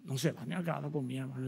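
almost whispered, quick and low; hushed aside. Retold or imitated. Pastor murmuring to a brother that the food won't run out, almost whispered. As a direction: drop to a murmur so only he hears. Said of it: "le digo yo".

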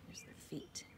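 soft pointing-out whisper; hushed aside over the picture. Pretend. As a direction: whisper it to the little ones.

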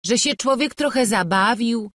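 high, loud self-justifying; indignant shrugging-off; dismissive protest. A narrator's reading. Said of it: "mówili, wzruszając ramionami".

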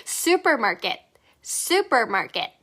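supermarket very slow with a firm su, falling; slow model supermarket. Shown as a demonstration. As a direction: start supermarket on a firm su, slowly. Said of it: "수라고 해줘야 되겠죠".

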